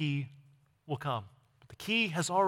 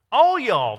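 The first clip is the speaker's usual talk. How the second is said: loud Southern drawl, higher pitched; Southern accent; 5 semitones higher, 9 dB louder than usual. Acted. Preacher reading a verse as "all y'all", Southern accent. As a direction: give it a good Southern accent. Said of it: "with a good Southern accent".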